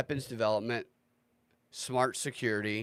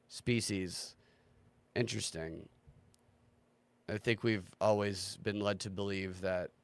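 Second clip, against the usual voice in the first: usual speaking voice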